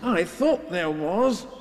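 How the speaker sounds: slow, high-lifted bear voice; Pooh. A narrator's reading. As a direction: answer slowly, lightly voiced as a bear. Pooh answers in the story.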